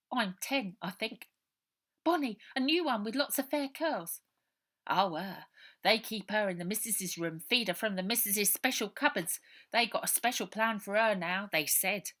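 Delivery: put-on a scared street boy pouring it all out; orphan boy Robert. Runaway boy telling Ida his story, loud and urgent.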